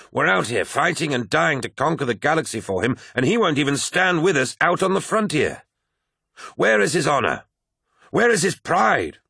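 high-pitched tirade building to indignant questions; impassioned rage. A narrator's reading. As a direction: rail at an absent master, climbing to the end. Abaddon rails against the Emperor.

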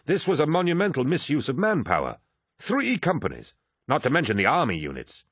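high-pitched, indignant condemnation. A narrator's reading. Angry captain condemns the blunder.

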